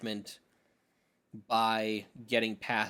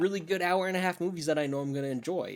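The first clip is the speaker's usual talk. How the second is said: higher, louder exasperation; exasperated retort; 6 semitones higher, 5 dB louder than usual. Retold or imitated. Mocking a long movie fan, higher and louder in exasperation. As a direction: throw the question back, worked up. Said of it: "and it's like".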